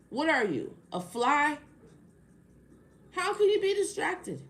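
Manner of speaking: high, loud, slow indignation; indignant scolding. Real, not acted. Lecturing viewers who claim they're distracted, high and loud. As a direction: scold the audience for making excuses.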